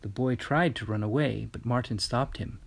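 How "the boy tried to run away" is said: usual speaking voice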